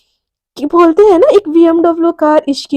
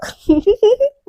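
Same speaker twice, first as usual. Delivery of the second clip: higher-pitched short put-on laugh; acted ironic laugh; 6 semitones higher than usual. Mishka scoffs at her supposed luck, ironic laugh. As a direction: laugh helplessly at the irony.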